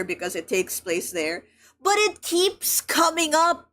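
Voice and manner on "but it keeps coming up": higher, slow, drawn-out exasperation; exasperated. Real, not acted. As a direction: stretch out each word in disbelief. Exasperated at recurring racism.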